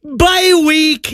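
high, teasing gloat, half-shouted; mock gloating. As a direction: rub it in like a smug fantasy manager. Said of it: "and you're just like".

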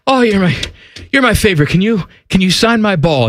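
put-on high-pitched, winded kid pleading in short bursts; halting, high, mock-winded plea; out of breath. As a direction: act a breathless young fan begging the player. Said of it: "I'm out of breath".

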